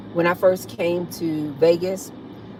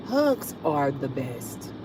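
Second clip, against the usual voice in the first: tender coo; 4 semitones lower, 6 dB quieter, much slower than usual. Real, not acted. Soft agreement.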